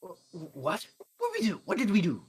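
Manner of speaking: high, loud panicked cry; panicked shock. Acted. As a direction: yelp it out in alarm. Suspects panic at police.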